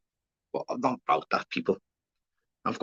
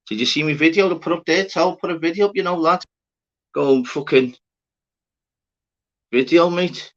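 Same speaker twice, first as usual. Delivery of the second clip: excited call-out; 11 semitones higher, 7 dB louder than usual. Real, not acted. Excited plug of his own video.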